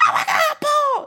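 very high, loud horrified cry; panicked scream. Retold or imitated. Retelling the horror scene, mock panicked shriek. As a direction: scream his name in terror. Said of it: "man come in there like".

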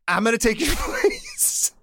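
high, slow words squeezed through laughter; helpless laughter. Real, not acted. Teller cracks up at switch-places twist, high helpless laughter. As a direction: barely get it out while laughing.

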